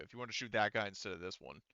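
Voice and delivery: ordinary talk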